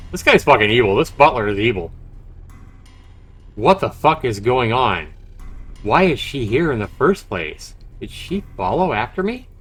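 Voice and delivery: normal talking voice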